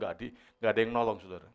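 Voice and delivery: normal talking voice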